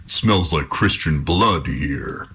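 slow, sniffing troll growl. Acted. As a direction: menace him like a hungry troll. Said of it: "said the troll".

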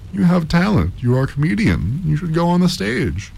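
high-pitched, delighted praise; delighted professor. A narrator's reading. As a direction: gush over the performer. Visiting professor praises bee imitation.

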